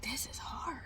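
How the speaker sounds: breathy whisper, half to himself; whispered complaint. Retold or imitated. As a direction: whisper it like you're out of gas. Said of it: "And I'm like".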